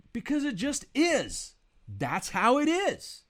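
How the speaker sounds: very high, loud exasperated shout; teacher yelling back. Retold or imitated. Frustrated teacher answering a yelling student, loud shout. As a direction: shout it back emphatically, pitch way up. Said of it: "got a little bit loud".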